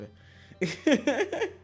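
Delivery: loud high giggle; high-pitched cackling laugh. Real, not acted. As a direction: burst out laughing. Laughs after acted plea.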